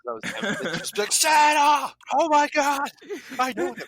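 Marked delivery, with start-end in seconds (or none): 1.1-3.8 high, excited shouting elf voice; Buddy the Elf. Retold or imitated. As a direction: yell it like a thrilled overgrown elf. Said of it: "my best Buddy the Elf impression".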